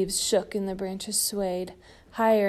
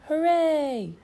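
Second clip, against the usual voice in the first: cheer; 8 semitones higher, 5 dB louder than usual. Acted. Everyone cheers.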